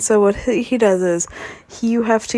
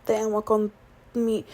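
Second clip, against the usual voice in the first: halting, losing the words; stumbling; 7 dB quieter than usual. Real, not acted. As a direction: trail off mid-phrase confused. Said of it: "oh my gosh, hold on, wait".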